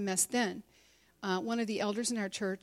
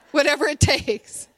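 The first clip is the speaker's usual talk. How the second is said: laughing; 6 semitones higher, 11 dB louder than usual. Real, not acted. Laughing after hysterical joke.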